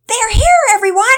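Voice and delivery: put-on high, piercing child's shout; very high, very loud announcement. A narrator's reading. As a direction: holler it to the whole house at full volume. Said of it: "Penelope shouted so loud".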